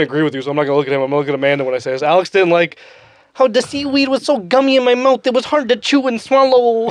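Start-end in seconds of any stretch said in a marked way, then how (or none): 3.3-6.9 mock a fussy child, pitched way up; baby voice. Teasing Alex about gummy seaweed, put-on baby voice. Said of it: "That's a baby voice".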